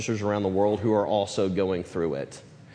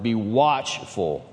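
"watchful" drawn out very slowly; stress on watchful; much slower than usual. Real, not acted. Preacher reading scripture to the church, stress on "watchful". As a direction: lean hard on "watchful", stretching it out. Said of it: "I'm emphasizing that word".

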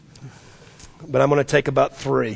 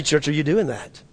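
normal talking voice

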